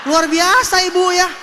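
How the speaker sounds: loud, high cry of wonder; amazed exclamation. Acted. Tenant marvels at recovered landlady, loud amazement. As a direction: exclaim it to the whole hall.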